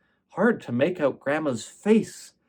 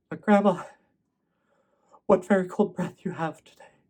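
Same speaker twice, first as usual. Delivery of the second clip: put-on a touch higher, eager little girl's voice; lightly voiced child calling out to grandma; little girl Jane; 6 semitones higher, faster than usual.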